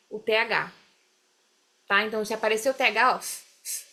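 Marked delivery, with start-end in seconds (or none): 3.2-3.8 soft voiceless puffs of air as an f; blown f puff. Shown as a demonstration. Teacher demonstrates the TH ending, soft blown f. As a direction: blow two quiet f sounds. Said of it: "esse assopro parece um F".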